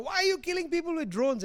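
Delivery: high-pitched mock yell at the president; mock heckling yell. Activist heckling Obama in a meeting, high mock yell. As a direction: shout the accusation up high, half-playful. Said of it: "she was yelling at Obama".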